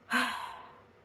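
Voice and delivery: long tired unvoiced sigh; weary sigh. Cop at the door, a weary sigh. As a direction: let out a fed-up breath. Cop sighs before speaking.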